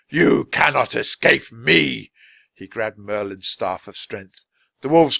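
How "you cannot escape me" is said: slow, louder menacing wizard; menacing threat. A narrator's reading. Wizard threatening the children, slow and menacing. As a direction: loom over them with the threat.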